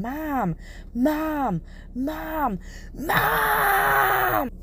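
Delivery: high-pitched mock screams, held back in volume; retold screaming for mom. Younger self calls for mom in sleep paralysis, mock screaming. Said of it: "remember screaming".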